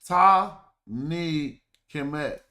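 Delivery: Ta ni Kemet, slow and spaced, each syllable drawn out; slow syllable reading. Shown as a demonstration. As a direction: read "Ta ni Kemet" carefully, syllable by syllable. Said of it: "Now this says".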